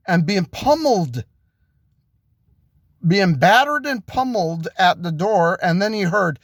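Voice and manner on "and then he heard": plain reading voice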